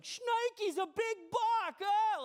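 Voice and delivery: high, loud startled yell; high loud yell at a big buck, slowed. Retold or imitated. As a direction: yell it as if the deer is right there. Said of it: "I yelled, like".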